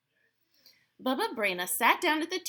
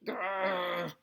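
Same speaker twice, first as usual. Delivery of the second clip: rolled bear growl; bear grumble; 2 semitones lower than usual. Acted. Old Bear grumbles at waiting for the menorah, growling.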